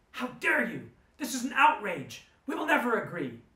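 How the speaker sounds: high, loud outraged yell. Retold or imitated. Vegan leader refusing the co-op proposal, loud indignant yell. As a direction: shout them down in outrage. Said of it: "she started yelling".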